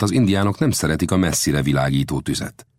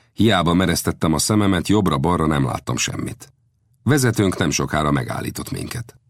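plain reading voice

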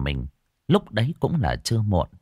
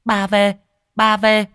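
put-on much higher, louder excited child; cheering child; 12 semitones higher, 5 dB louder, slower than usual. Little girl cheering for her grandmother, high and excited. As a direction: squeal with joy as grandma arrives. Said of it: "reo lên rối rít".

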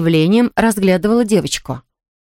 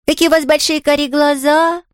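put-on high, tender child's admiration; affectionate little girl; 8 semitones higher than usual. A narrator's reading. Girl admiring the invalid's eyes, affectionate. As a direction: coo the compliment in a girlish voice. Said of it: "ласково сказала девочка".

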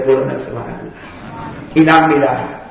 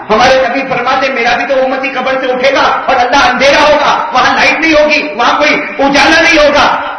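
high, louder preaching; fervent preaching; 9 semitones higher, 6 dB louder than usual. Acted. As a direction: build up the image with fervour. Preacher's rising climax. (پرجوش خطابت)

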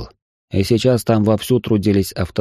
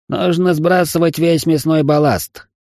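higher, slow, grumbling robot voice; disgruntled creaky robot; 8 semitones higher than usual. Acted. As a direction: creak it out slowly like a displeased robot. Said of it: "недовольно проскрипел Зигзаг". (недовольный скрипучий голос робота)

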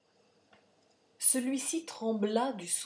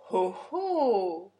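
a louder scornful "oh, oh"; mock exclamation; 7 dB louder than usual. A narrator's reading. The count scoffs at the murmuring crowd, mock surprise. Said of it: "s'écria le comte".

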